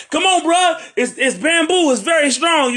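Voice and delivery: high excited plea. Retold or imitated. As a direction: pitch it up, pleading. Quoting a fisherman.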